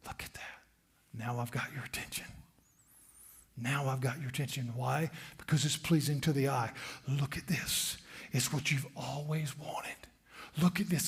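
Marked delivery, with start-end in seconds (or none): 0.1-2.3 soft lower whisper, luring; tempter's whisper. Acted. Tempter luring the listener, whispered softly. 7.1-11.1 soft whispered lure; tempting whisper. Acted. Tempter offering the dream, whispered.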